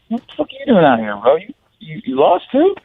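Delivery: startled and incredulous. Retold or imitated. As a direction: pitch jumps up in surprise. Surprised meeting kid in woods.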